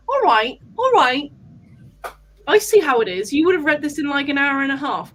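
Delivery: loud, teasing, pretending to be offended; mock indignant. Real, not acted. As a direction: act put out, playfully. Teasing the fast reader.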